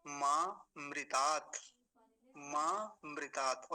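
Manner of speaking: मामृतात् slowly as maa, mri-taat; separate new word. Shown as a demonstration. Teacher modelling the next word, slow and separate. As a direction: hold maa, then clear mritaat in मामृतात्. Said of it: "ये नया शब्द है आगे का".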